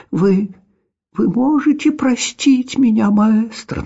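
high, pleading little cat. A narrator's reading. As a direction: beg forgiveness in a high cat voice.

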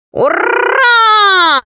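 high, stretched-out victory whoop; triumphant cat cry. A narrator's reading. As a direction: cheer in a put-on high cat voice. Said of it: "закричал кот". (ликующий крик кота)

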